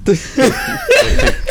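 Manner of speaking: high, loud, drawn out through laughter; cracking up. Real, not acted. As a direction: laugh helplessly while saying it. Cracking up at friend's laughing fit.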